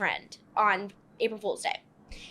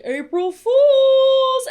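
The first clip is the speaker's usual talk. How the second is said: gleeful dad gotcha; 12 dB louder, much slower than usual. Retold or imitated. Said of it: "he's like".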